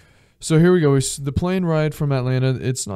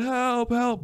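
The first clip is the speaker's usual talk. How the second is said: mock cries for help; 12 semitones higher than usual. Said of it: "People are laying down screaming".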